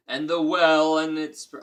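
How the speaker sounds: loud giant bellow; giant growl. A narrator's reading. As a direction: bellow it again. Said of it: "growled the credulous giant".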